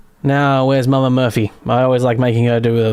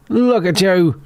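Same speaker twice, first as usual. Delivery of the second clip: higher cooing voice for a kitty; 6 semitones higher, slower than usual. Real, not acted. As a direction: coo sweetly at the cat.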